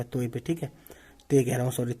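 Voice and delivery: ordinary talk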